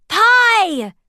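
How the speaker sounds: high, loud gleeful shout. A narrator's reading. Dwarfs shouting the answer together. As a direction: yell it out together. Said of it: "shouted all of the dwarfs".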